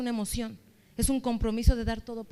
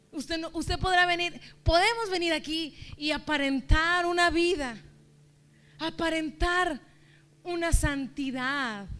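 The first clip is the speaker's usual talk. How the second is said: higher, slowing down on aparentar; emphatic preaching; 5 semitones higher than usual. Real, not acted. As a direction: drag out "aparentar" to expose the pretense. Preacher stresses pretending in church. (predicación enfática, alargando "aparentar")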